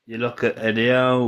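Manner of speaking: ordinary talk, nothing marked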